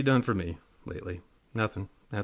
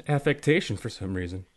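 ordinary delivery

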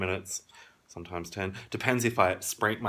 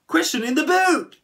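loud, very high segment title shout; hyped-up, shrieked title call; game-show announcer; 5 dB louder than usual. Acted. Announcing the vlog's question segment.

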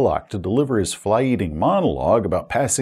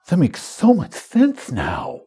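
slow, higher-pitched dawning realisation; mock amazement; 5 semitones higher, slower than usual. Players marvel at the villain's explanation, mock-amazed. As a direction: play the awestruck player, drawn out.

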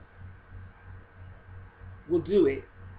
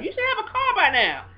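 friend's remark; 14 semitones higher, 6 dB louder than usual. Retold or imitated. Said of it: "friend said something about".

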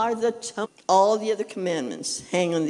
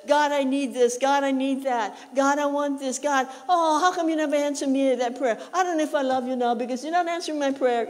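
higher, nagging complaint to God, speeding up and half-laughing at the end; whiny demanding prayer; 5 semitones higher than usual. Retold or imitated. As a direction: play a spoiled believer whining at God for unanswered prayers. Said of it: "and said".

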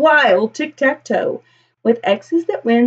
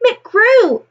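admiring townsfolk; 11 semitones higher, 4 dB louder than usual. Acted. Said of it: "they will say".

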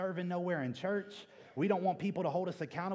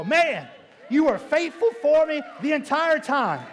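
loud, high, jubilant cry of thanks to God; grateful shout; 9 semitones higher, 13 dB louder than usual. Acted. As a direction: shout it out with thanks for his faithfulness. Voices his own grateful cry.

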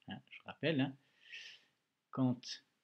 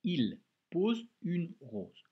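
slow, carefully spaced dictation sentence; slow dictation reading; 5 semitones higher, 3 dB louder, much slower than usual. Shown as a demonstration. Teacher reads the dictation sentence to a pupil, very slowly. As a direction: read it word by word for the dictation. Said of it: "On commence, on lit cette phrase".

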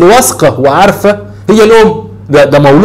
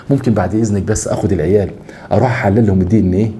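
softer, hushed pleading husband; sheepish request; 7 semitones lower, 7 dB quieter than usual. Pretend. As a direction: ask her gently, afraid she'll take offence. Husband asks wife for DNA test.

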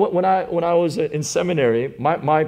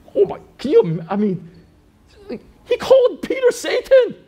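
much higher, laughing out the words; laughing disbelief; 6 dB louder than usual. Real, not acted. Pastor to church, laughing in disbelief. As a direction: laugh in amazement.

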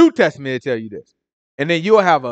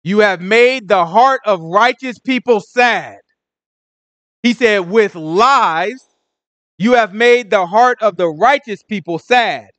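high, loud, slow proclamation; full-force preaching; 8 semitones higher, 7 dB louder, slower than usual. Real, not acted. Preacher hammering the verse home to listeners, full-force preaching. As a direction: drive each word home like a street preacher.

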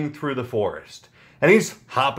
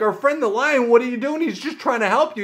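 higher, alarmed voices of the watching animals; shocked animal friends; 7 semitones higher than usual. Acted. Giraffe and elephant cry out at the lion, raised and shocked. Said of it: "and they're like".

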